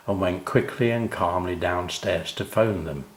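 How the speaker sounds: ordinary delivery, nothing marked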